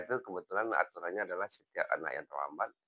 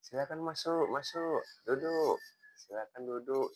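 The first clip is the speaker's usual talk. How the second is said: welcoming invitation; 7 semitones higher than usual. Retold or imitated. Teacher welcoming a late student into class, kindly. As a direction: usher her in kindly, drawing out masuk.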